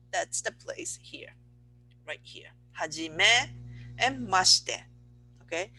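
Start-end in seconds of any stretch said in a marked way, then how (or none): 2.8-4.8 Hajime, then a short pause, then mashite; pause after hajime. Shown as a demonstration. Teacher showing students where to pause. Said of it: "if you wanna pause somewhere, hajime".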